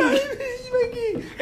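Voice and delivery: very high, drawn-out squeaky falsetto; badly faked impression, squealed through laughter; mock teacher imitation. Friend teasing about bad accents, in absurdly high falsetto. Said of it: "everybody's doing the, um, imitation".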